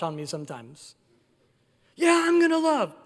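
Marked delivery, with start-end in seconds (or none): 1.9-3.0 mock eager pledge.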